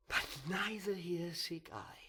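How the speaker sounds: bitter whisper. Acted. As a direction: drop to a whisper, as if to himself. Brooding villain soliloquy.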